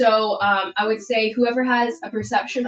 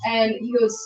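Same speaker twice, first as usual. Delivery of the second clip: usual speaking voice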